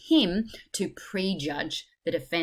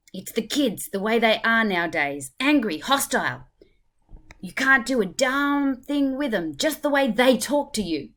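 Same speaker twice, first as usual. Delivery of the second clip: raised, loud indignant complaint; angry rant; 6 semitones higher, 6 dB louder than usual. Acted. Third juror complaining about kids nowadays, angry. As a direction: rail against the youth of today.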